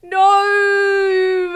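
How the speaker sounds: loud, high anguished cry of no; horrified shout. Real, not acted. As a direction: scream no in dismay. Horrified reaction to Von Karma.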